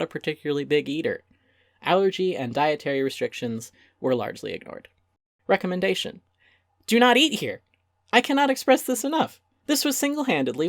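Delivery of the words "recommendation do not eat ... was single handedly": high, loud exasperated warning; emphatic verdict. Acted. Reviewer warning readers off the restaurant, high and emphatic.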